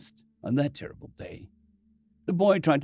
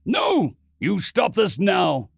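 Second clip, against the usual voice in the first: loud, slow furious shout; 5 semitones higher, 4 dB louder, slower than usual. Acted. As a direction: yell at him to stop. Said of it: "Ronson shouted".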